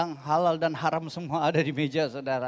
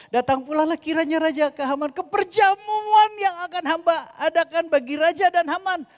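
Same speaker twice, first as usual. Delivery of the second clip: fervent preaching; 11 semitones higher, 5 dB louder than usual. Real, not acted. Preaching builds to climax.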